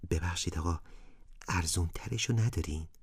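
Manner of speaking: whispered, shy question to the shopkeeper; a timid boy's whisper; hushed asking. A narrator's reading. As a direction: ask softly so parents won't hear. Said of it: "ابراهیم آهسته از فروشنده پرسید".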